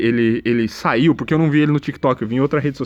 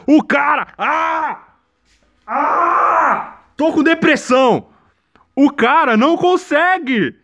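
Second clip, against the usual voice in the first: exasperated outburst; 12 semitones higher, slower than usual. Real, not acted. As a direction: throw your hands up and shout it. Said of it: "frustrated outburst". (explodindo de irritação, gritando agudo)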